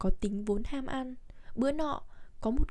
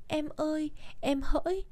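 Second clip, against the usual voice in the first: higher pretend lament, lightly done; mock mourning; 5 semitones higher, 3 dB quieter than usual. Husband showing wife his future lament, mock mourning. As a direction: lightly play at wailing for a spouse. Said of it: "mình khóc ra làm sao".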